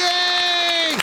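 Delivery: high sarcastic cheer; mock celebratory yay. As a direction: cheer like a fake fan. Mocking celebration of signing.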